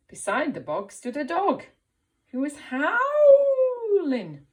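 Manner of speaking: lively read-aloud, howling swells high and loud; storytelling. A narrator's reading. Teacher reading a picture book aloud to the class.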